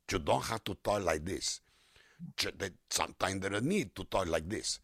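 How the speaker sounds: exaggerated Mexican accent; strong Mexican accent. Shown as a demonstration. Voice actor showing what is too strong, heavy Mexican accent. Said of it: "They don't have to be particularly strong".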